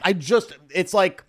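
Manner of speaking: normal talking voice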